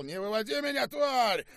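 very high, enraged yell; furious shout. A narrator's reading. General loses patience with the prisoner, furious shouting. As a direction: escalate to a screamed threat.

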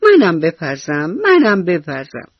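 high, loud, slow squeal of joy; excited little mouse. Acted. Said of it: "موشی با خوشحالی گفت".

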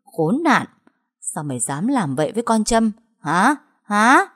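furious scolding, rising to a loud yell on the last Hả; angry shout. Acted. Mistress yelling at the daughter-in-law after a slap, angry shout. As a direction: slap the words out at her in rage. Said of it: "rồi quát lớn".

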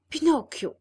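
high, loud call of his name. A narrator's reading. Candlewick calling to Pinocchio, loud. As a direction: call him over.

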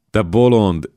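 loud, high playful shriek; a lover's loud mock scream. A narrator's reading. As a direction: squeal it out at him, teasing. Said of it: "sikoltott Mara".

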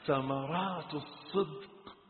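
soft, slow repetition; subdued refrain. Real, not acted. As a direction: let the phrase out quietly and drawn out. Same phrase falls quiet. (تكرار هادئ ممدود)

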